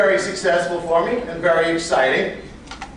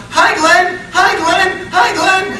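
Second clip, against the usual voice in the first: mock girls greeting; 12 semitones higher, 7 dB louder, slower than usual. Speaker imitating club girls calling to his friend, high and loud. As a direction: squeal the greetings like eager girls.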